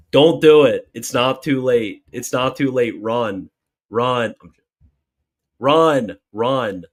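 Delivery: joke-warn the groom to flee; mock alarm. Mock warning to newly engaged viewer.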